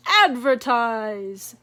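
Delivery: slow, loud, booming; chief bunny. Acted. Chief Easter Bunny answering, slow loud booming command. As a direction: pronounce it like an order.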